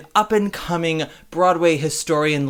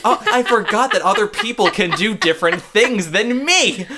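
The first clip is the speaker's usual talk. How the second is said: high, giddy mock revelation; excited retold reaction; 10 semitones higher, 6 dB louder than usual. Host gushing about meeting fellow theatre nerds, giddily excited. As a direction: play up the delighted discovery. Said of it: "I get really excited".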